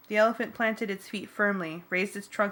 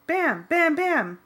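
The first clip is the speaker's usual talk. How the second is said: mock crash noises; 8 semitones higher, 5 dB louder, much slower than usual. A narrator's reading. Hitting more trash cans.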